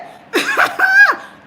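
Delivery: loud high burst of laughter; high laughing squeal. Real, not acted. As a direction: shriek with laughter. Laughing loudly.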